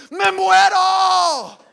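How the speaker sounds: very high, loud, slow hammed-up wail; dying wail. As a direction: wail it out like you are dying. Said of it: "Y yo le dije". (lamento exagerado de dolor, agudo y lento)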